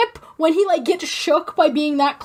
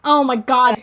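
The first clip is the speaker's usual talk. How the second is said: normal talking voice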